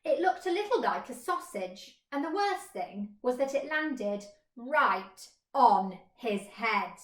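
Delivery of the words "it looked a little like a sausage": usual speaking voice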